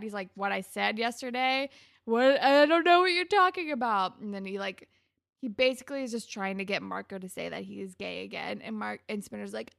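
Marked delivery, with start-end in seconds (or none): 0.3-4.2 mock innocence. Spinner feigning ignorance.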